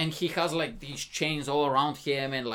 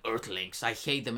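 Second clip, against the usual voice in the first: low, softer mock-sullen voice; retold hero; 7 semitones lower, 7 dB quieter than usual. Hero answering the British guy, low mock-sullen voice. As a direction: drop down into understated disdain. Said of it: "he was like".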